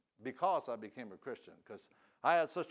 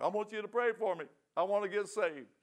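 higher pitched, imitating a bawling drunk; mock tearful plea; 7 semitones higher than usual. Drunk man begging his brother for prayer, mock tearful plea. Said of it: "now I'm crying. I'm a bawling".

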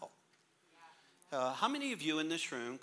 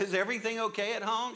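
higher, louder worried stranger voice; a lady checking on a child, raised pitch; concerned lady; 9 semitones higher, 5 dB louder than usual. Retold or imitated. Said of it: "And she said".

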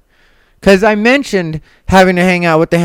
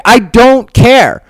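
slow, higher, louder I don't care; emphatic dismissal; 6 semitones higher, 5 dB louder, much slower than usual. Retold or imitated. Answering podcast critics with a slow, loud, emphatic brush-off. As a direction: lean on each word, slow and firm. Answering people who misread her.

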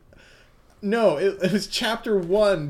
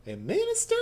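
Southern Belle; 11 semitones higher, faster than usual. Acted. GM voicing Mitsuba to Yasuho as a sweet Southern belle. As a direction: play the genteel Southern lady, all honey. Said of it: "doing her Southern Belle".

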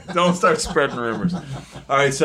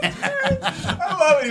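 laughing; much slower than usual. Real, not acted.

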